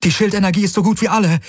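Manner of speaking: high, rapid alarmed cry; urgent call. A narrator's reading. Kirai reports failing shields to the pilot, urgent call. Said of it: "rief Kirai".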